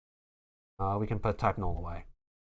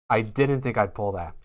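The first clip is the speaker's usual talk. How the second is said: ordinary talk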